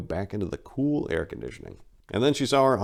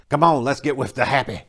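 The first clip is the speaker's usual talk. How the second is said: put-on high, louder, off-voice old man; eager old man; 6 semitones higher, 5 dB louder than usual. The old man in the alley, giddy and eager. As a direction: coax her, eager and giddy.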